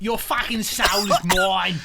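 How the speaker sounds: slow, loud hammed-up Australian villain line; mock Australian accent. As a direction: growl the taunt in a cartoon Aussie voice. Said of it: "This Australian accent is choking me".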